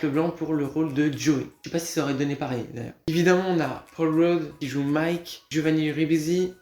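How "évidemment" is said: usual speaking voice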